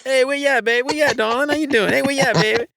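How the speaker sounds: affectionate New Orleans Yat hellos; New Orleans Yat greeting. Retold or imitated. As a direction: call out fondly like a New Orleans local. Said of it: "that's a New Orleans thing".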